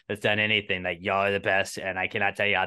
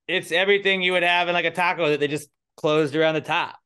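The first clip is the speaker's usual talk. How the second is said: loud insistent; 8 semitones higher, 6 dB louder than usual. Real, not acted. Loud heated argument.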